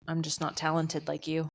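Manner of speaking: ordinary talk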